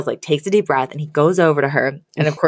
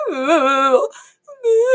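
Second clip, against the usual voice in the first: high, hammed-up fake sob; fake crying; 10 semitones higher than usual. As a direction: fake a sob to win sympathy. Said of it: "starts to pretend to cry".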